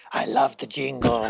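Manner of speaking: low, gruff growl of a voice. Retold or imitated. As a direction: do his gruff jingle voice. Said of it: "you have this gruff, gruff voice that you just use".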